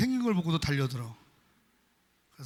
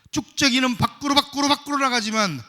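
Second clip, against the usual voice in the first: loud, higher push on 밖으로; loud preaching; 4 semitones higher, 8 dB louder than usual. Real, not acted. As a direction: drive the chaff outward, louder. Chaff thrown outward, preached loud. (쭉정이는 밖으로 외치듯 크게)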